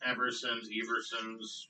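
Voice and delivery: "Eversons" with an EV- start, repeated softly; corrected pronunciation. Shown as a demonstration. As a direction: say "Eversons" as EV-er-sons, correcting yourself.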